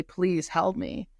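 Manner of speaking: usual speaking voice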